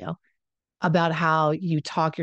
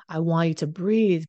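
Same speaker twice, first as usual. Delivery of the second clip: usual speaking voice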